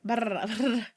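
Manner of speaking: slow trilled tongue roll; rolled-R demo. Shown as a demonstration. Showing a friend how to roll Rs, trilled brr. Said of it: "I just go by, like".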